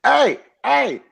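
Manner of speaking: high, loud calling hey; yelling. Retold or imitated. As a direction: call out over a bad mic. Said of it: "I'm constantly having to be like".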